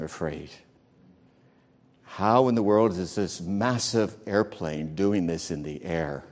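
low, soft prayer murmured in fear; hushed frightened prayer. Retold or imitated. Preacher retelling his prayer on a shaking plane, hushed and afraid. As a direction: pray quietly, afraid of dying in the turbulence.

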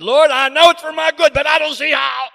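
high, loud pleading cry to the Lord; crying out to heaven. Retold or imitated. Preacher retelling his prayer, crying out loud to the Lord. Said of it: "I've cried out to heaven and said".

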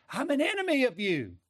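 a hammed-up rebel's voice; defiant sinner voice. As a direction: fling it back at God. Voicing the rebellious flesh.

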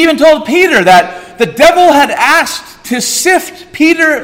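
high, loud, rising pulpit preaching; preaching at full force. Acted. Preacher assures the church God holds them, full-force preaching. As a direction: drive it home to the congregation. Preacher builds over devil's limits.

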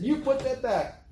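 retold clerk scolding a thief; stern command. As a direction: scold the shoplifter. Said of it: "But she said".

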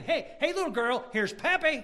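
put-on high, laughing greeting to a child; playful grandpa. Grandfather greeting the little girl in the photo, high and laughing. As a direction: call out to her with a chuckle. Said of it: "I'm thinking".

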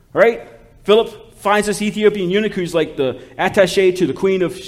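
urgent, high-energy sermon delivery; forceful preaching. Real, not acted. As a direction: drive the point home to the congregation. Preaching forcefully about the Bible.

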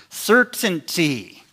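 "Certainty" drawn out slowly, falling; emphasized word. Shown as a demonstration. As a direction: linger on "Certainty" like it's underlined. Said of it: "when you want to emphasize a word".

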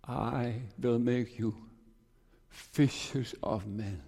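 slow, hushed quoting of the verse; soft and slow. Retold or imitated. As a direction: let the promise land softly. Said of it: "this text came to me".

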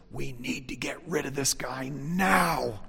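slow, hushed scheming voice; suspenseful crime show narrator. Retold or imitated. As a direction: lean in like a plotting villain. Conspiratorial paraphrase.

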